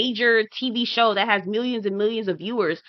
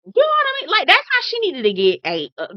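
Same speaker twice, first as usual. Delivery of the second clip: worked-up insistence; 10 semitones higher, 4 dB louder than usual. Real, not acted. Insists emphatically on her point.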